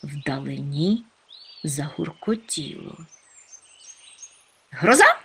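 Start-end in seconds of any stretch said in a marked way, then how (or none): 4.7-5.2 high, loud, startled squeal; squealed question. A narrator's reading. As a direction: yelp it as a rising question. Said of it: "верескнув Чмих". (переляканий високий вереск)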